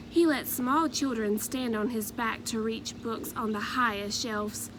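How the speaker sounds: usual speaking voice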